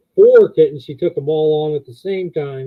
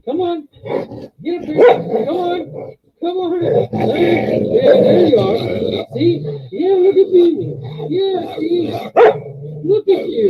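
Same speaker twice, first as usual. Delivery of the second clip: much higher, sing-song cooing at the dog; 12 semitones higher than usual. Real, not acted. Coaxing dog onto lap, cooing.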